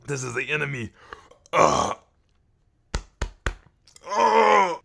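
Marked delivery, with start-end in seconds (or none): 4.1-4.8 sudden high loud grunt; strained shout. Real, not acted. Preacher fighting hiccups, strained yell. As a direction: force the sound out.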